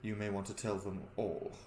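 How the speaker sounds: low, soft, measured godly voice; solemn, refined god. Acted. God explains his bound soul to a mortal, low and solemn. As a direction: speak quietly with grave restraint. Game master plays Bahamut.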